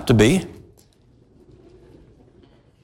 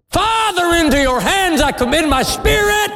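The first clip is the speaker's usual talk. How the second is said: high, ringing cry from the cross; loud cry. Retold or imitated. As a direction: lift Father high and call out. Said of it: "he cried with a loud voice".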